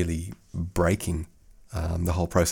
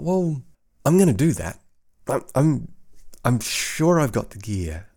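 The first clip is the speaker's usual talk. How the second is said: high, louder burst of enthusiasm; eager resolve; 6 semitones higher, 5 dB louder than usual. Retold or imitated. Podcaster recalling his decision to make a trailer, excited. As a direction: retell your keen decision with a lift.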